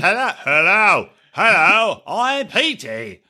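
hammed-up British accent, drawn out; practicing British accent. Joking that Pete practices at home, mock British accent. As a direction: try out a silly British accent. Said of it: "He's just practicing his accent".

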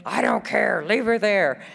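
high-pitched mock outburst; worked-up, dismissive imitation; retold angry dad. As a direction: imitate a furious father refusing to budge. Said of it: "he was just really mad".

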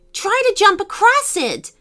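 high-pitched shouted urging; urgent call. A narrator's reading. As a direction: call across to a friend in danger. Urgent order across a widening crack.

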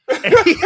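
high, loud burst of laughter; big laugh. Real, not acted. Laughing hard while telling the Barry story. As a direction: crack up mid-story.

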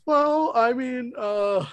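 a high, slow, far-off stammer from the caught smuggler; flustered smuggler. Acted. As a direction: stall nervously like a cornered smuggler.

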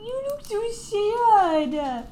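high, very slow, cooing pet voice; baby talk to dog. Acted.

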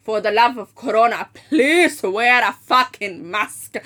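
mock fed-up plea, leaning hard on each please; exasperated plea. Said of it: "I'm like".

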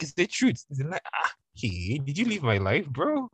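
half-joking indignant comeback; mock exasperated retort. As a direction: play it incredulous and cheeky. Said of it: "I'm like".

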